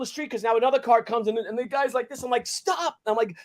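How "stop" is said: retold calling out. Bystander stopping an oncoming car, urgent call. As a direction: call out a halt as in a retelling.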